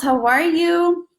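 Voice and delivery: high, slow, stretched how are you; singsong greeting. Real, not acted. Greeting a viewer warmly on her live stream, high singsong. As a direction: sing the greeting up high to a friend.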